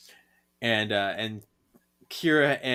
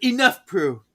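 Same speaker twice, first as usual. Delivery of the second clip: mock commanding shout; 8 semitones higher, 7 dB louder than usual. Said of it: "He's like".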